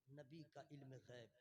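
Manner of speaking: low, soft "नबी का इल्म गैब"; hushed aside. Real, not acted. Preacher introducing the Prophet's knowledge, quietly. Quiet take before loud repeat.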